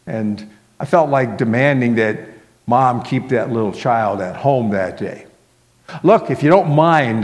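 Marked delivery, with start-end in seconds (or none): 6.0-7.2 louder, higher, irritated brush-off; testy, raised-voice request; rather cross. A narrator's reading. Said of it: "I said rather crossly".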